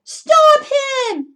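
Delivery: very high loud shout; yell. Acted. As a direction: cry out in a chase. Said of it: "yelled the people".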